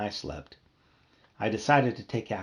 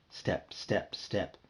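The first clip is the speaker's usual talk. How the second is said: soft, breathy step step step; hushed footsteps; 3 semitones lower, 7 dB quieter, much slower than usual. A narrator's reading. Narrator sounding out someone coming into the kitchen, hushed. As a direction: hush it like creeping footsteps.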